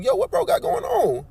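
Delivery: high, slow incredulous question; watching a coworker leave, high and drawn-out in disbelief; shocked curiosity. Retold or imitated. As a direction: leap way up in disbelief. Narrator reacting to coworker storming off.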